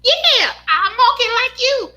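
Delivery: put-on very high, squeaky Mickey Mouse-like toddler; Justin as a little boy. Little son proudly tells Mama he copies her, squeaky child voice. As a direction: imitate a small son piping up in a very high voice. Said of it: "Justin used to sound like Mickey Mouse".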